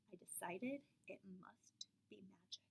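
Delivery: soft whisper of wonder; hushed and awed. A narrator's reading. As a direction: breathe it like magic. Child imagines magic.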